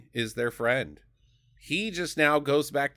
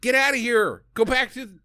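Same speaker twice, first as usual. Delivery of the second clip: shooing yell; 9 semitones higher, 4 dB louder than usual. Retold or imitated. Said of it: "she could have just been like".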